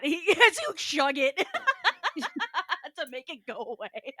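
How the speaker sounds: words squeezed out between laughs; giggling commentary; laughing. Real, not acted. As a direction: laugh through it. Laughing at bad soda.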